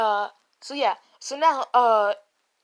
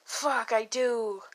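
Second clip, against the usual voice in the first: slow, drawn-out confession; inner admission; 2 semitones lower, 6 dB quieter, much slower than usual. Acted. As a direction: admit it slowly, as a thought. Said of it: "in his head".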